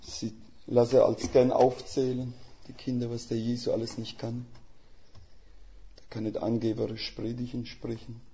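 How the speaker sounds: usual speaking voice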